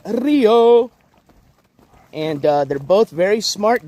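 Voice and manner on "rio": drawn-out, louder Spanish "Rio"; Spanish pronunciation. Shown as a demonstration. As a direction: call out "Rio" in a showy Spanish accent. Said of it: "the English pronunciation would be river, but he is called".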